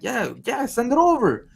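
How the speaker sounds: high, ready agreement; eager customer. Retold or imitated. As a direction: say yes without a second thought.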